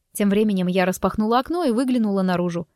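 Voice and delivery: usual speaking voice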